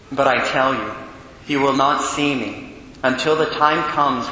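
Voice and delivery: solemn, measured liturgical reading; Gospel reading. Acted. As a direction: proclaim the scripture to the congregation. Reads the Gospel at Mass.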